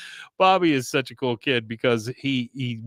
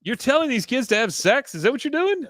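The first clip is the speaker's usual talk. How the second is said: much higher incredulous questions; appalled disbelief; 12 semitones higher than usual. Real, not acted. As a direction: demand an answer in shock. Host appalled at father's joke.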